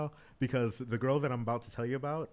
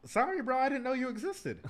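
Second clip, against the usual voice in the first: mock apology; 13 semitones higher than usual. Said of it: "And I was like".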